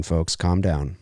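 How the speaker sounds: ordinary talk, nothing marked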